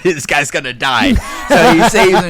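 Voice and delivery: chuckling through the quote; laughing. Real, not acted. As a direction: laugh while saying it. Laughing while quoting friend.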